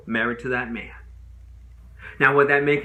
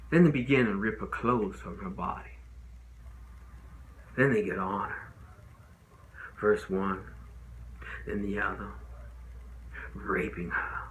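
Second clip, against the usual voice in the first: grave, hushed recounting; 5 semitones lower, 8 dB quieter than usual. Acted. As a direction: lower and softer, heavy pauses. Grim account of assault to jury.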